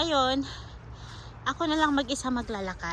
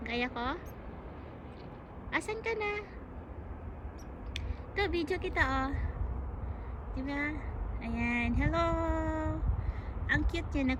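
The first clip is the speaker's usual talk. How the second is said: cooing to a squirrel; 4 semitones higher, 5 dB quieter than usual. Real, not acted. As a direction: coax the squirrel closer, sweetly. (malambing na pagtawag sa ardilya)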